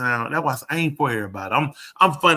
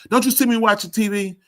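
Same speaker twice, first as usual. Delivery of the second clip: higher, annoyed and louder; irritated brush-off; 8 semitones higher, 4 dB louder than usual. Retold or imitated. Wife annoyed that husband interrupts her TV, irritated. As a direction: scoff at being interrupted.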